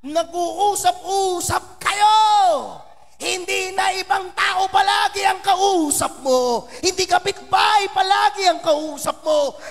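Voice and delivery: loud, high and slow exhortation; preaching shout. Real, not acted. Pastor exhorting the families, loud and slow. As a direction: command the families to talk.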